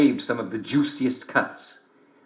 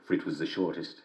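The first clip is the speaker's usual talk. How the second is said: usual speaking voice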